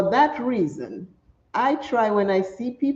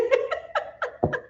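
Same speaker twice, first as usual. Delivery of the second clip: breathy laugh; 7 dB quieter than usual. Real, not acted. Laughs listing her outfit.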